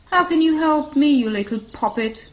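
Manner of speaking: loud, high despair, easing at the end; desperate giraffe. A narrator's reading. Crying giraffe doubting the tiny mouse, loudly desperate. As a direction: cry out in hopeless disbelief. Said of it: "the giraffe said desperately".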